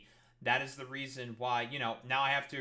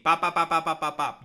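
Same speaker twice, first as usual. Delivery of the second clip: playful zap noise; 2 semitones higher, 10 dB louder than usual. Pretend. Imitated zapping noise in the bit.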